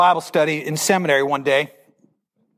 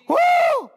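high whoop. Acted. Reacting to the heavenly voice.

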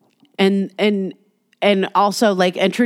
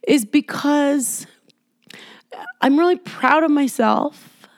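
real tears held back, near a whisper; choked up; 7 semitones higher, slower than usual. Real, not acted. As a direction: push through the lump in the throat, very slowly. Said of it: "I'm getting emotional".

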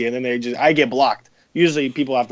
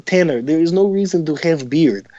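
higher, slow East European scolding; father's East European accent; 5 semitones higher, 3 dB louder than usual. Acted. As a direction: imitate an East European dad telling off his son. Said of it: "I can do my father really well".

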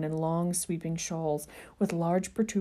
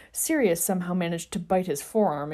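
ordinary delivery, nothing marked

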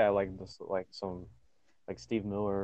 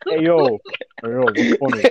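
mock indignant; 6 dB louder than usual. Mock offense at laughter.

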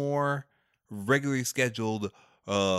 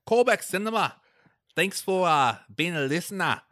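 a light mock Australian accent drifting Scottish, pitched up; put-on Scottish-ish accent; 6 semitones higher, 3 dB louder than usual. Host signing off in the podcast's Australian name, lightly mock accent. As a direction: say it like a bad Aussie accent. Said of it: "my terrible accent".

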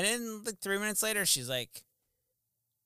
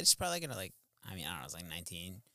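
usual speaking voice

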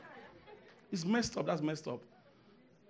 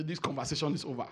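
quieter, quicker mock dismissal; soft mock dismissal; 4 semitones lower, 8 dB quieter, faster than usual. Preacher softly repeating the dismissive line, quick. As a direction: toss it off softly.

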